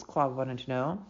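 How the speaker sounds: plain reading voice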